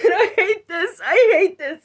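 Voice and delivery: loud, high, sobbing; crying out. Retold or imitated. Reliving despair before throwing the phone, sobbing. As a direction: wail it through tears. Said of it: "And I said".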